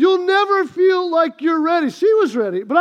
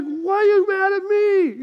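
higher, protesting mock husband; raised-pitch, hammed-up protest; defensive husband; 4 semitones higher than usual. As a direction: act out a husband pleading innocence. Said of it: "He's like".

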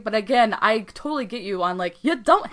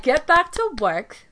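slow, louder, higher mock command; retold stern order; 6 semitones higher, 5 dB louder, slower than usual. Goddess ordering the podcaster out of the underworld, imitated sternly.

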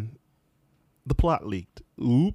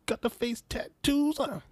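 mocking voice; 13 semitones higher, slower than usual. Mocking the Joker look.

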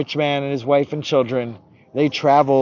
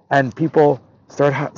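ordinary delivery

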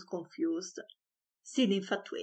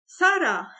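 loud, high call for the maid; raised voice; 10 semitones higher, 9 dB louder than usual. A narrator's reading. Father summoning the maid, calling out loudly. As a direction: bellow the maid's name across the house. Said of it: "He raised his voice".

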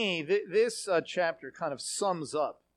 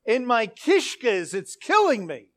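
loud, emphatic complaint of inner pain; groan-worthy torment voiced out loud; heartfelt, hammered-home agony; acted gut anguish; 3 semitones higher, 6 dB louder than usual. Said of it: "it basically means something to the effect of".

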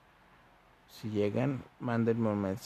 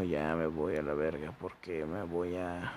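ordinary talk, nothing marked

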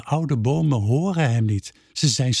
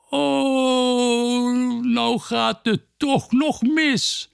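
despairing wail; 10 semitones higher, much slower than usual. A narrator's reading. Peerke despairs, cry heard.

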